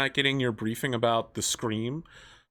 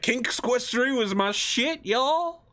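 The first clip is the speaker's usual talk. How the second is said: high, slow mocking voice; mock gamer brag; 11 semitones higher, much slower than usual. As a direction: drawl it out like a smug gamer kid. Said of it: "He's like".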